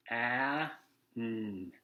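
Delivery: ordinary delivery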